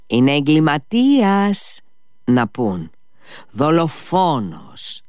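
slow, emphatic scorn; scornful Ivan. A narrator's reading. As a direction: spell out the accusation slowly, with contempt.

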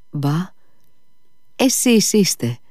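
high-pitched cry of recognition; exclaiming. A narrator's reading. Mitya recognises the old woman in the dark, exclaiming. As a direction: call out in sudden recognition. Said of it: "φώναξε ο Μήτια".

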